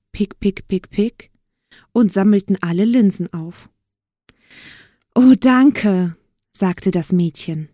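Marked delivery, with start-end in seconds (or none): 5.1-6.2 higher, louder grateful exclamation; delighted thanks. A narrator's reading. Girl thanking the birds for their help, delighted. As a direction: gush with relief.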